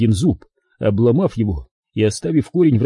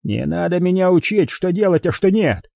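higher-pitched, irritated rebuke; angry growl; 7 semitones higher than usual. A narrator's reading. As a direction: narrator voicing an angry snarl, pitched up. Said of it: "прорычал Харрисон". (раздражённый рык)

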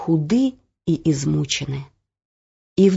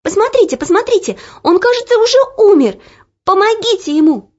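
frightened plea; 13 semitones higher, 6 dB louder, faster than usual. A narrator's reading. Olya begs help for the boy.

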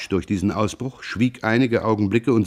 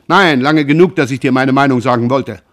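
loud, high furious shouting; 4 semitones higher, 7 dB louder than usual. Acted. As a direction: scream the accusations at him. Jean shouts his threat.

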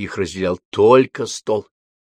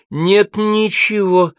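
high, drawn-out call back; crying out; 12 semitones higher, 5 dB louder, much slower than usual. A narrator's reading. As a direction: shout back slowly at a raised pitch. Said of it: "крикнула Виола". (крикнула в ответ)

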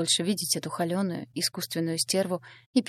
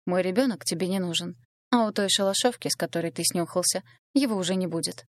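plain reading voice

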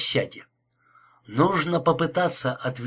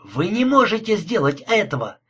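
higher-pitched, understated cry of protest; restrained narrated outcry; 6 semitones higher than usual. A narrator's reading. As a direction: protest urgently without a full shout. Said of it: "закричал Гарри".

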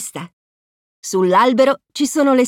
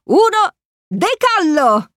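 slow, higher, triumphant liftoff; liftoff call; 7 semitones higher, slower than usual. A narrator's reading. Crew counts down to launch, excited liftoff call. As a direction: stretch out the launch cry.

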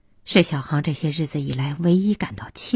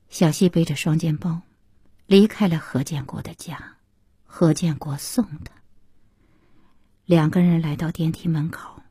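plain reading voice

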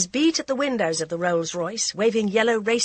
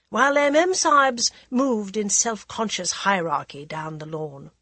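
ordinary delivery

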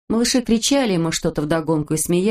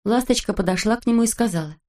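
ordinary delivery, nothing marked

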